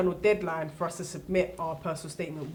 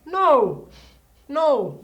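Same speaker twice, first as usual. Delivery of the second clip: loud, higher flat no; emphatic no; 8 semitones higher, 12 dB louder than usual. Real, not acted. Student answers own rhetorical question with loud no. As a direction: slam down the answer.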